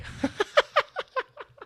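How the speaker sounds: high, trailing burst of laughter; laughing. Real, not acted. Man cracking up at being called a wet bag, high laugh. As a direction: crack up helplessly at the insult.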